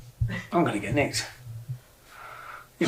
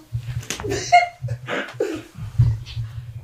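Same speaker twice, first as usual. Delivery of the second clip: nervous laugh; 6 dB quieter than usual. Real, not acted. Nervous laugh about getting arrested.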